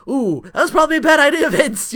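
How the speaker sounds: high, fast teasing warning; mocking ooh. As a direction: rub it in with a sing-song ooh. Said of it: "I'm like".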